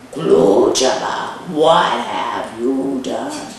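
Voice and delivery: low, slow, drawn-out accusation; suspicious grandmother. Acted. Grandmother presses him again.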